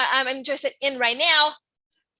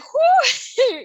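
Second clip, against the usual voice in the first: overcome with emotion; 10 semitones higher, 5 dB louder than usual. Real, not acted. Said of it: "even now emotion-, emotional".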